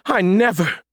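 high, indignant Southern lady; affronted genteel huff; huffy. A narrator's reading. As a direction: take great offence, pitched right up. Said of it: "she huffed".

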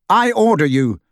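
loud firm command; fierce. A narrator's reading. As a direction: bark the order at him. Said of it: "said Harry fiercely".

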